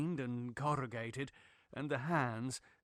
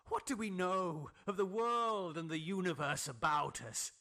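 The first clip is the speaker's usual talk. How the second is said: much higher, far-off voice of an obsessed scientist, lecturing; high, unnatural pedantic voice; 6 semitones higher than usual. A narrator's reading. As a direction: lift into a strange high register and preach the theory. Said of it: "talking in a high and unnatural, though always pedantic voice".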